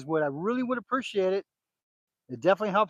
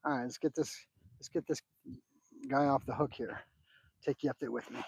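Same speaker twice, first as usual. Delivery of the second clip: hushed, soft voice to the fish; fishing whisper; 5 semitones lower, 11 dB quieter than usual. Real, not acted. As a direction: keep it low while unhooking. Hushed talk while landing fish.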